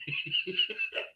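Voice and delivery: squeaky soft laugh; high giggle. Real, not acted. Telling the funny part to viewers, high soft giggle. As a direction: giggle at the memory.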